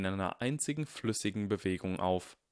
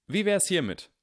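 high, loud public announcement; 11 semitones higher, 7 dB louder than usual. A narrator's reading. Harry announces his idea to everyone, loudly. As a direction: call it out to the crowd. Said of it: "sagte Harry laut".

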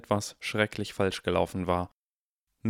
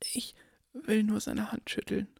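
put-on very high, softer old lady; whispering old woman; 12 semitones higher, 5 dB quieter than usual. A narrator's reading. Old woman begging to shake Harry's hand, high and soft. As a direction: plead softly like a frail old woman. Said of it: "flüsterte die Frau".

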